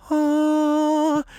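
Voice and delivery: a high breathy gasp of wonder; awed gasp. Retold or imitated. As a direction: gasp at the beautiful roses. Opening fridge, awed gasp.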